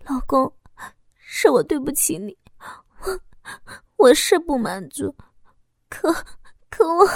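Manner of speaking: tearful, halting apology; moved, gentle. A narrator's reading. Wife apologizing to her husband, moved and faltering. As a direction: falter between phrases as if near crying. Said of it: "我感动地轻声地说道".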